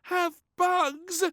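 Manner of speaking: very high, loud, slow wail; a loud high child's wail. A narrator's reading. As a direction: wail in protest. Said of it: "wailed little Princess".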